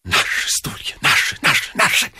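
unvoiced, fierce whispered exclamations; whispered jubilation; inward exultant cry. A narrator's reading. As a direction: exult under your breath, word after word. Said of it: "Об этом кричал весь его организм".

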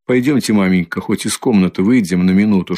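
ordinary delivery, nothing marked

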